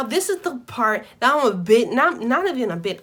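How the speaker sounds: ordinary talk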